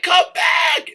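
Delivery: high, shouted plea; Rose's cry. Retold or imitated. Rose calling to the boat, high shouted plea. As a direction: yell after the rescuers. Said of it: "she's like".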